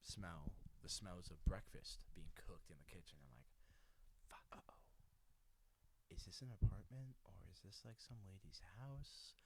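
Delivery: hushed sneaky whisper. Retold or imitated.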